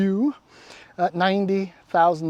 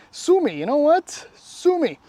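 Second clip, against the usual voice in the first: defiant sue me; 9 semitones higher, 3 dB louder than usual. Real, not acted. Defiant over calling color boring.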